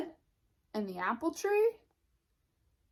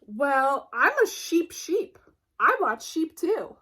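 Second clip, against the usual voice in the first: high, loud proud sheep voice; boastful sheep; 4 semitones higher, 6 dB louder than usual. Acted. Sheep brags to Sheepdog about watching sheep, high and proud. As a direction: puff up and brag.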